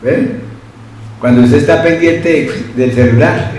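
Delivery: ordinary talk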